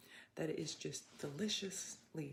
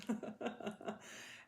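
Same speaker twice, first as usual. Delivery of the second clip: bright short laugh; amused laugh; 6 semitones higher than usual. Real, not acted. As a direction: laugh it off lightly. Laughs after the line.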